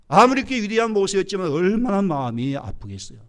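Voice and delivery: normal talking voice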